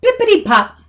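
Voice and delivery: high, bright pop; playful sound effect; oil can pop. Acted. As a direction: squeeze out a pop.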